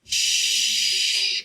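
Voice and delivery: one drawn-out hushing sound; sustained unvoiced hush; long shush. Real, not acted. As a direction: shush them at length. Hushing the opponent.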